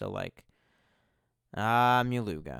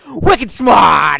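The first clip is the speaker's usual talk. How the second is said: loud shouted Wicked smart, higher; Boston shout; 7 semitones higher, 19 dB louder than usual. Retold or imitated. Imitating the museum phone for chat, loud Boston shout. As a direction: yell it like a Boston recording. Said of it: "there's just".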